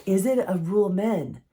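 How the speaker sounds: usual speaking voice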